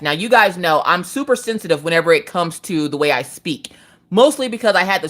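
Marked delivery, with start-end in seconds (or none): none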